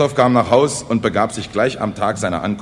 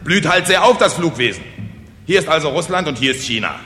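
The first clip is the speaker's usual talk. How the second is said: loud, high-pitched indignant explanation; 6 semitones higher, 4 dB louder than usual. Acted. Speaker corrects the puzzled peasants, indignant. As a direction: explain it as if affronted. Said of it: "erwiderte Kosonossow empört".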